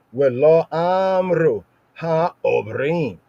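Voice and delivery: "Ha, Obrim" drawn out slowly with a throaty ayin on "Obrim"; emphasized ayin. Shown as a demonstration. Teacher stressing the hard sound for students, slow and deliberate. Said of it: "I just emphasize the ayin there".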